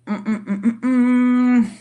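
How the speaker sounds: ordinary talk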